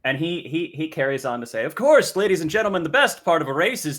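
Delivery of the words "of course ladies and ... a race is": loud, higher herald proclamation; royal herald. Acted. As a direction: announce it to the crowd. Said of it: "Pigeon has more speech".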